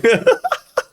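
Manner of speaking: short high-pitched laugh. Real, not acted. Laugh after joke to Pardew.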